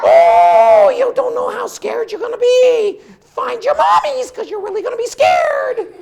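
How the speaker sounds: high, loud mock-scary taunt; spooky teasing warning. Storyteller teases the children to find their mommies, loud and high. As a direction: tease the kids they'll be scared. Teasing audience about fear.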